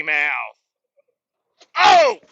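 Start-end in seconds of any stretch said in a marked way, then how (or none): 1.8-2.2 very high, loud "oh!" of surprise; sudden real yelp at a jolt; startled yell. Real, not acted. Startled by ramp in road.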